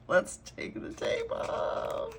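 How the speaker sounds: breathy hushed whisper, slow and high. Real, not acted. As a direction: whisper it as you peel the tape. Peeling tape, nervous excitement.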